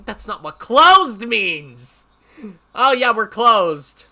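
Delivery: loud, high-pitched incredulous objection; mocking outrage. Real, not acted. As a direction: shout in disbelief at the absurd logic. Outraged mockery of contradictory sign.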